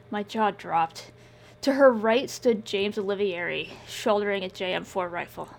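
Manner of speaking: ordinary talk, nothing marked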